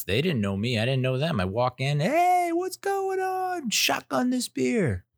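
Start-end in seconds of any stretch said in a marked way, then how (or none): 2.0-5.0 very high, hollered party-host voice; stretched-out, high-pitched mock yell; rowdy party greeting. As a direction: imitate a wild reveler welcoming a guest. Party host greets him, acted.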